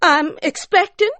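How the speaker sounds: high-pitched insistent mountain wife; high demanding wife. Acted. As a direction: press your claim, high and sharp. Ariella voiced.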